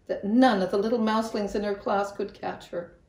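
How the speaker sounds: plain reading voice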